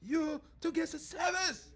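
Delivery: put-on high, slow Chinese-accented threat; huffy woman with a Chinese accent; indignant Chinese-accented complaint. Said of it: "she goes".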